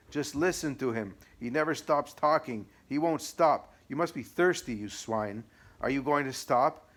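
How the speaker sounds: abusive devil. Acted. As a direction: spit every insult at the priest. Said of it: "the devil began to abuse him".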